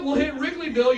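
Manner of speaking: ordinary talk, nothing marked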